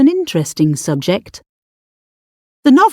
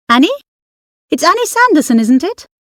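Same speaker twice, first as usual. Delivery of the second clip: high, sharp calling out; a woman suddenly recognising an old friend, pitched high; high and sharp, calling across the carriage; sharp woman's voice; 8 semitones higher, faster than usual. Acted. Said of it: "A woman's sharp voice".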